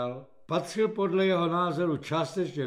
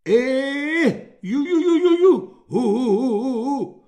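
high loud "ju ju, hu hu" noises; simpleton's hooting; 8 semitones higher, 10 dB louder than usual. A narrator's reading. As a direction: hoot like a witless fool. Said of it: "udělal slabomyslný vojín".

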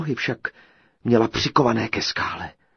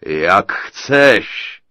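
louder, smug giant's reply with a chuckle; giant chuckling; 12 dB louder than usual. A narrator's reading. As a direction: sneer like a giant amused. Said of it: "zachechtal se obr".

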